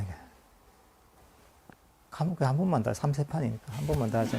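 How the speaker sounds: ordinary delivery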